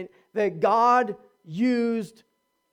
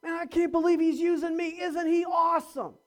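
higher, gushing about being broken yet used; amazed; 7 semitones higher than usual. Shown as a demonstration. Preacher models the humble grateful testimony, amazed. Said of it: "that's what we point to".

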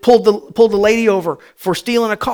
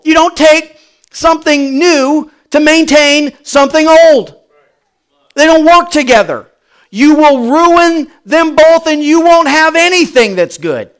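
louder, punched sermon delivery, slowing on ruin them both; full-force preaching; 8 semitones higher, 8 dB louder than usual. Acted. Pastor warning the congregation, full-force preaching. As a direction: drive it home from the pulpit.

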